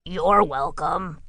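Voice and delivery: slow, softened gruff voice; gentle beast. A narrator's reading. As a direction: say it kindly, slowly. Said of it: "said Grackle".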